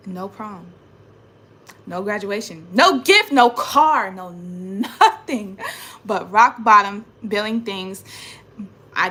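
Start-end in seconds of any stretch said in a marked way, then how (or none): 2.7-4.1 much higher, louder complaint; "No gift, no car" viel höher und lauter; bitter outburst. Real, not acted. High loud outburst. 4.1-5.6 slow, laughing "no nothing"; "no nothing" langsam, lachend; bitter laugh. Real, not acted.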